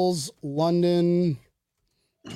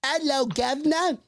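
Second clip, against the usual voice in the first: high, badly faked Australian accent; hammed-up Australian accent pitched way up; 9 semitones higher than usual. Shown as a demonstration. Host showing off practiced accent, bad Australian accent. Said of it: "that's my Australian accent".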